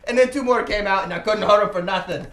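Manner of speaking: high, loud, incredulous telling; excited storytelling. Acted. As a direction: pile on the wild story loudly. Character excitedly tells his goblin tale.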